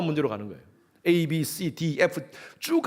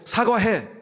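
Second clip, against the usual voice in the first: demanding snap; 7 semitones higher, 3 dB louder, much slower than usual. Acted. Spouse demanding an apology.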